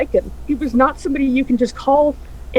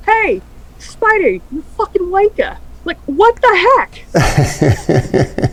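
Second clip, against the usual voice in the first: mock yell; 6 semitones higher, much slower than usual. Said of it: "yells at your friend".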